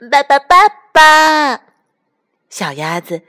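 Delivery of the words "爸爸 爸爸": high, loud, drawn-out duckling call. A narrator's reading. Duckling climbing on fox's nose, calling insistently. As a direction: stretch the cry for papa, high and loud.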